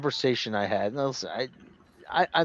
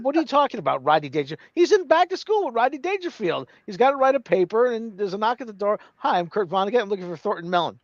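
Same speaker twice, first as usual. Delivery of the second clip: retold indignant girl; 7 semitones higher than usual. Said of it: "She said".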